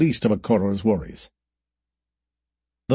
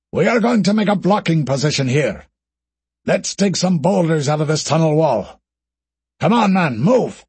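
urgent command; 5 semitones higher than usual. Acted. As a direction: push the troops to hurry. Alistair urges troopers.

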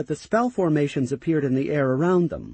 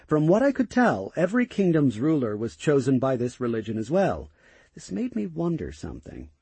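ordinary delivery

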